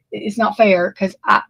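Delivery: normal talking voice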